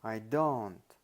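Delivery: "don't" punched loud with a fall; stress on DON'T. Shown as a demonstration. Lecturer showing how emphasis suggests others do, with stress on "don't". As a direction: lean hard on "don't" after a light "I". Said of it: "is emphasized".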